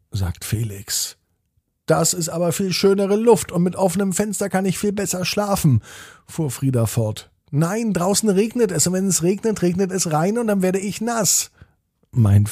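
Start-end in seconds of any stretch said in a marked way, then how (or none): 1.9-5.8 fast, higher-pitched counterargument; quick, insistent sibling retort; arguing child. A narrator's reading. Twins arguing over the window. 7.5-11.5 higher-pitched, run-on objection; insistent childlike protest; protesting child. A narrator's reading. Twins arguing over the window.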